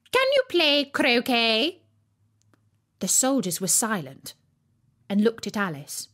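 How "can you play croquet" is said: high, louder, slow; imperious queen. A narrator's reading. Queen demanding an answer from Alice.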